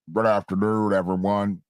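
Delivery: put-on low mumbling old senator; Mitch McConnell impression. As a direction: mumble like a mouthful. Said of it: "Here's Mitch McConnell".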